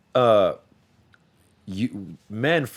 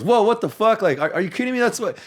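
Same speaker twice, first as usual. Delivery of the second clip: outraged guy; 12 semitones higher, 4 dB louder than usual. Acted. Bobby's character outraged in skit.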